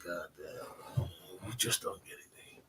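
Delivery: hushed dismissive aside to the hater; whispered scoff. Retold or imitated. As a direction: breathe it out in disbelief.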